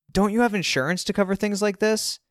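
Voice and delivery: exasperated, grasping at options. A narrator's reading. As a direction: challenge dad, pitched right up. Exasperated son challenging his dad.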